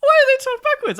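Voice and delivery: high, loud surprised squeal; mock astonishment. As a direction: squeal it out in fake disbelief.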